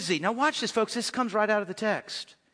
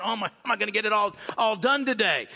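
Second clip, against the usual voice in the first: fast, flustered mock worry; mock frazzled rush; faster than usual. Preacher mocking a frantic, swamped God in a fast rush. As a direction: rattle it off like a swamped person. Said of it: "God is never overwhelmed and going".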